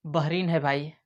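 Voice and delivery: usual speaking voice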